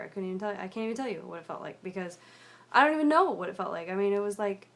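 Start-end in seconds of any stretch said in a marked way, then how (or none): 2.7-3.9 distressed outburst. Real, not acted. Overwhelmed describing the breakdown.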